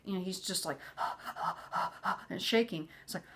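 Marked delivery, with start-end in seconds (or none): 0.8-2.3 imitated hyperventilating; panicked gasps. Husband panicking during house negotiation, mimicked gasping. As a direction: gasp as if having a panic attack. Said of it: "he just starts having a panic attack".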